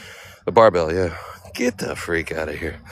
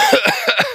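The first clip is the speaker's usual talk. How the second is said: cough; 4 dB louder than usual. Real, not acted. Coughing, out of breath.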